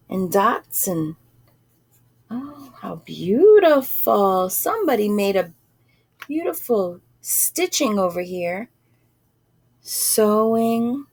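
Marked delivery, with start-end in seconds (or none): none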